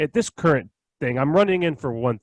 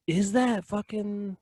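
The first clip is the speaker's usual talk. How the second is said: slow suspicious; 6 semitones higher, 5 dB quieter, much slower than usual. Retold or imitated. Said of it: "she could have been like".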